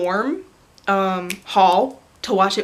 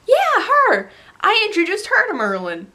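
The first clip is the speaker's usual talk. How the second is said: high, loud burst of recognition; imitated excited revelation; 9 semitones higher, 3 dB louder than usual. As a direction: act out a friend's thrilled reveal. Retold friend's amazed revelation.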